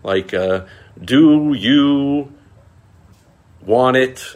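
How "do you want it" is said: loud, high imitation of a clumsy message; mock broken English. Imitating the scammer's broken English, loud and high. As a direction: read it like a bad scam text. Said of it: "some broken English message".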